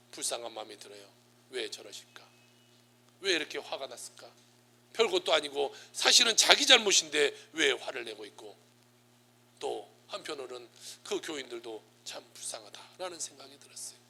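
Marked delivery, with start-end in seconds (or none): none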